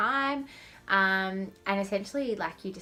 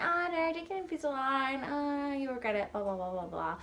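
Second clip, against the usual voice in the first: lightly mocking, higher warning voice; mock naysayer voice; 6 semitones higher than usual. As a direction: imitate a know-it-all friend. Mocking people who discourage her.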